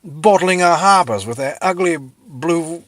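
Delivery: high, loud, slow put-on Englishman's voice; exasperated British. British complaining about the French blockade, put-on exasperated voice. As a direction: huff like a fed-up redcoat. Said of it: "the British were like".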